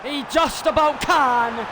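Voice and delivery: thrilled slow call; excited exclamation. Real, not acted. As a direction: draw it out with rising excitement. Excited repeat during live play.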